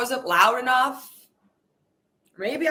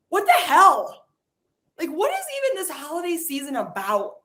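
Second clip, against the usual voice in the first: exasperated outburst; 6 semitones higher, 4 dB louder than usual. Acted. Angry rant about the world.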